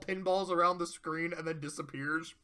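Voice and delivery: ordinary delivery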